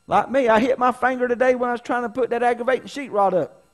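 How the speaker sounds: loud, high, fervent preaching voice; preaching at full force. Acted. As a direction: preach it out to the church, full power.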